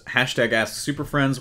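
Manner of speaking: usual speaking voice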